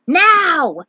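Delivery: loud, high command shout; battle shout. Acted. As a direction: bark the signal to attack. Firion gives the signal.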